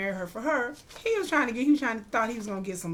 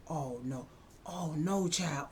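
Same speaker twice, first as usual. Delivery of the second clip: soft, lowered "oh no"; hushed dread; 4 semitones lower, 7 dB quieter, slower than usual. Retold or imitated. Viewer watching Morris say goodbyes, hushed dread. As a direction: sink into quiet foreboding.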